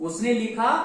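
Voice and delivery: ordinary talk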